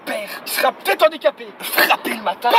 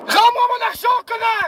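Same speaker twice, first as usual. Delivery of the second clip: high, louder comic demand; mock angry yell; 6 semitones higher, 5 dB louder than usual. Driver yelling at a Swiss car in mock fury.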